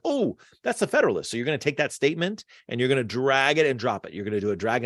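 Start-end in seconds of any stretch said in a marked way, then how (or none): 0.0-1.3 eager realization. Pretend. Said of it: "you're gonna be like".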